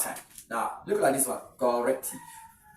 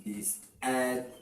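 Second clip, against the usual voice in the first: "This advantage" spelt out in widely spaced syllables; 4 dB quieter than usual. Shown as a demonstration. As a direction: pause after each syllable of "This advantage". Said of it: "if you have to transcribe it".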